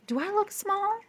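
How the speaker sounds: high, anxious question; Pluto voice. A narrator's reading. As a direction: ask the moons, worried, up high. Pluto asks his moons.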